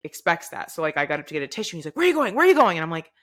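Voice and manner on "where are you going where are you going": high, loud, quick-fire challenge in imitation; retold urgent demand. Dad challenging child who gets up mid-argument, retold urgent demand. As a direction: act out an alarmed parent calling after someone.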